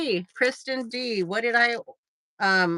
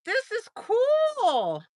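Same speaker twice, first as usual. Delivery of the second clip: delighted; 11 semitones higher, much slower than usual. Real, not acted. Delighted at new reply feature.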